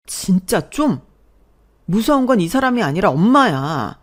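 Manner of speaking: angry son. Acted. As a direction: stop her meddling. Son argues back at his mother. (엄마에게 화내는 아들)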